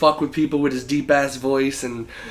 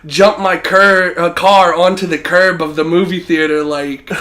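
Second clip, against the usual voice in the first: excited; 4 semitones higher, 6 dB louder than usual. Real, not acted. Laughing storytelling climax.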